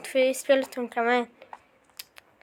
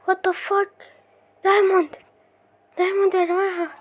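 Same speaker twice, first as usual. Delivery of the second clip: high-pitched astonished exclamations; stunned and thrilled; amazed; 6 semitones higher than usual. Real, not acted. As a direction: react in real disbelief, pitched up. Amazed at finding a diamond.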